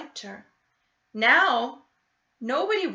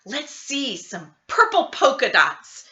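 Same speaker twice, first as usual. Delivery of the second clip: put-on louder teasing kid's call; yelled; 3 semitones higher, 8 dB louder than usual. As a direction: kid shouting across the classroom. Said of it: "One yelled out".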